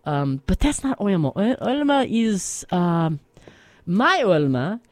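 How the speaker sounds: put-on broken English with a heavy Russian accent, reminiscing; Russian-accented grandma character spinning a tale on the radio. Plays Russian grandma Olma telling story.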